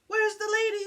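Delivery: high, anxious calling out; a lost dog asking for his owner; worried dog voice. Acted. Lost dog calls out for the lady, anxious and high.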